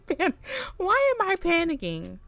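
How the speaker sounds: flustered rising panic; panicked. Real, not acted. Panicking player.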